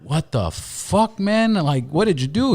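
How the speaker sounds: high, slow shocked "what the fuck"; jaw-dropped surprise at his friend's new body; stunned disbelief. Retold or imitated. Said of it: "and Kevin Hart's like".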